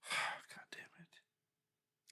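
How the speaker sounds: breathy under-the-breath whisper; whispered curse. Retold or imitated. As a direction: mutter it to yourself, resigned. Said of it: "And I'm like".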